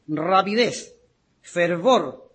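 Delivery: ordinary delivery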